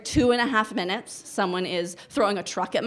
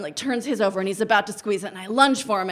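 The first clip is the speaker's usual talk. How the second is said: normal talking voice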